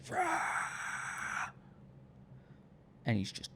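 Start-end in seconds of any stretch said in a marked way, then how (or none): 0.1-1.5 mock roar.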